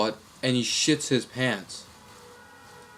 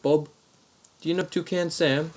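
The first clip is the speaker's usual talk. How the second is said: higher-pitched Aussie prank caller; mock-Australian voice, pitched up; Australian accent; 5 semitones higher than usual. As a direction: quiz in a put-on Australian accent. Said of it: "same Australian accent".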